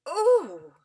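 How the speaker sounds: put-on boy crying out a long sudden Oh. A narrator's reading. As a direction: let out a long startled Oh. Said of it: "Diggory gave a long".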